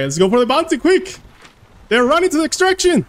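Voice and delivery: high, loud, fast hurry-up call; urgent callout. Real, not acted. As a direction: rush the team, voice climbing. Urgent callout chasing bounty.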